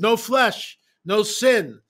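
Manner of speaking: high, loud battle cry; loud proclamation. Retold or imitated. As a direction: proclaim it to the riders. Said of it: "I said".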